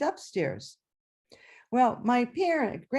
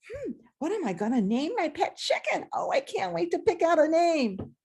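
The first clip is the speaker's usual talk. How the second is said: put-on eager child; 7 semitones higher than usual. Child dreaming of pet name.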